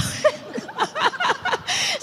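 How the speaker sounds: high, drawn-out giggle; high-pitched real laughter. Real, not acted. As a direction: laugh helplessly at your own remark. Amused by her own blunt remark.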